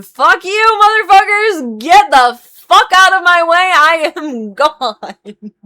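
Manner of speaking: imagined quitting scream, very high and loud; furious scream. Pretend. Groomer's fantasy of screaming at the bosses as she quits, furious scream. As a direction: scream it out full force, stretching the last word. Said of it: "I would scream".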